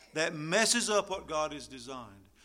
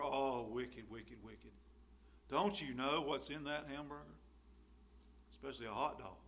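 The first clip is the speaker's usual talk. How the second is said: mock disapproval; 4 semitones lower, 11 dB quieter than usual. Mocking a disapproving ascetic.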